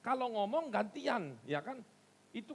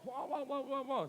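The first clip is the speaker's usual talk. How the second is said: higher, rapid run of babbled syllables; imitated ecstatic prophesying; 5 semitones higher than usual. Congregant prophesying during the service, imitated high babble. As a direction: mimic someone babbling in prophecy. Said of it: "dia sedang berbuat".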